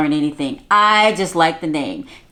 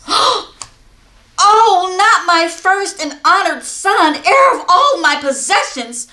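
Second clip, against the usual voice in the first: high, loud, frantic cry; a mother panicking for her son; alarmed mother; 9 semitones higher, 5 dB louder than usual. Acted. Mother sending her son for help, frantic and high. Mother gasps at terrible news.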